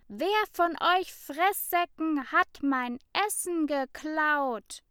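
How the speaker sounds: high, loud furious demand; angry accusation. A narrator's reading. Ida accuses the whole class of theft, angry. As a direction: hurl the accusation at them.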